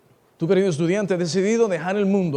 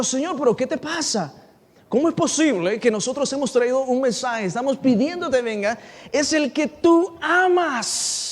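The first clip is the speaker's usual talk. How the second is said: higher-pitched incredulous appeal; exasperated pleading; 4 semitones higher than usual. Acted. Acted pleading with Jesus.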